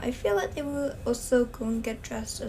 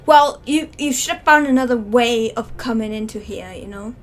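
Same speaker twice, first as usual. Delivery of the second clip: loud scolding; 8 dB louder than usual. Pretend. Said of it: "he'd be like".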